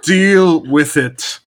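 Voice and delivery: on request, a Scottish ogre's line in a mock Scottish accent. Shown as a demonstration. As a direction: bellow it like a cranky Scottish ogre. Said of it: "If you can lean into the Scottishness".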